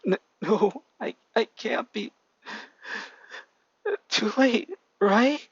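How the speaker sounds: high, cracking disbelief; breaking down. A narrator's reading. Boy breaking down before his sister, high and cracking. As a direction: let the voice break in despair. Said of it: "breaks down".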